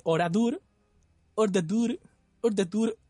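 put-on polished Turkish accent. Reading the Turkish dub to friends, joke Turkish accent. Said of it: "el acento turco lo tengo pulido".

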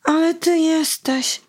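a hint of an indignant girl; little girl voice. Basia reproaching the boy, lightly voiced girl. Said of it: "powiedziała Basia do chłopca".

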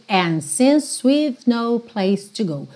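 "And since" with no D, slow; dropped D in and. Shown as a demonstration. Teacher modeling "And since" without the D. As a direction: slide from N to "since". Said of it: "do N pro since".

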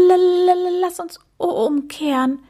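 high, stammered plea to turn back; stuttering. A narrator's reading. Frightened Mucks begs his friend to turn back, stuttering. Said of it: "stotterte er".

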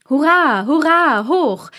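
high, loud festive cheer; joyful cheering. A narrator's reading. Townsfolk toasting midnight, loud cheering. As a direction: cheer in the new year. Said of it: "sangen die Leute".

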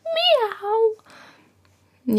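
high, drawn-out cat meow. Retold or imitated. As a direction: meow like a cat yelling at neighbours. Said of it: "schreit sie immer vom Balkon runter".

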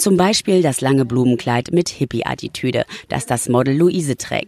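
slow, low reporter voiceover; voiceover narration. A narrator's reading. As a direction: narrate it like a TV feature.

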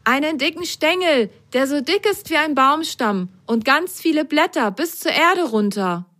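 high little sock describing; high childlike character voice. Acted. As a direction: tell it as an awed little sock. Character reports the view.

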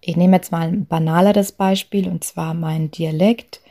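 ordinary delivery

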